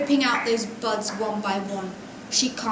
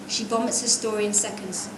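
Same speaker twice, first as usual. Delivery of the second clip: usual speaking voice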